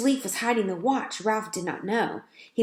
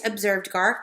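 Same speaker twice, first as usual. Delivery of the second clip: usual speaking voice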